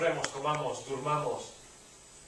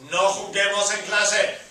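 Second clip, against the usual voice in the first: louder, firm command; emphatic; 4 semitones higher, 9 dB louder than usual. Shown as a demonstration. Teacher tells students not to play, firm and loud. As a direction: insist on it. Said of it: "teacher repeats command".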